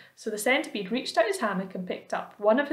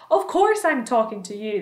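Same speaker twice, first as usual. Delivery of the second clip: louder, testy reply; impatient; 2 semitones higher, 7 dB louder than usual. A narrator's reading. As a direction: snap back at James impatiently. Centipede answers impatiently.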